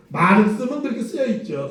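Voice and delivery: usual speaking voice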